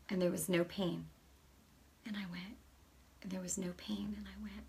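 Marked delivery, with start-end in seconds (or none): 2.1-4.6 much softer, almost whispered; hushed. Retold or imitated. Testing her healed arm, hushed in wonder. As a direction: breathe it in quiet disbelief.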